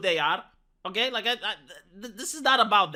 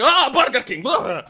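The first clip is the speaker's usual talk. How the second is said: dismissive, louder scoff at the brand; mock disgust; 5 dB louder than usual. Everyone mocking the brand, scoffing. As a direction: sneer the name like it's a joke. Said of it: "everyone goes like".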